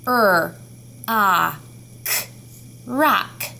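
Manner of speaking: rock as Er, ah, k, then rock; teacher modelling sounds of rock; sounding out. Shown as a demonstration. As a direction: segment rock and blend. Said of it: "Tell me the sounds of rock".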